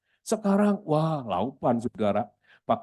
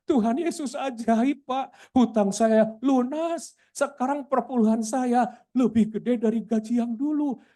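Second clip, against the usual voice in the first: high-pitched retold elation; acted-out triumphant report; jubilant testimony; 9 semitones higher than usual. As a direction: testify to a miracle, voice lifted high. Acts the worker's joyful testimony.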